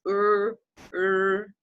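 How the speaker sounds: "er" held long and steady, said twice; isolated, drawn-out "er" for learners; demonstrated vowel. Shown as a demonstration. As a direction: sound out "er", then repeat it. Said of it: "you have to do this".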